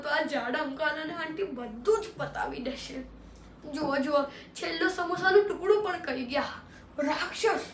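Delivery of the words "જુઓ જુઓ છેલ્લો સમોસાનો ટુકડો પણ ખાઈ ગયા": ordinary delivery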